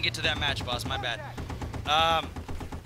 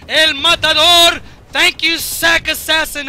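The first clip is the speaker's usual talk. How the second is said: hyped announcer call; 8 semitones higher, 9 dB louder, slower than usual. Acted. Hyped call of own kill.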